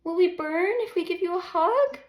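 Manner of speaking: put-on high, loud childlike question; high loud child's worried question; little girl asking. A narrator's reading. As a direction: ask like a worried small child. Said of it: "asks little Ginger D".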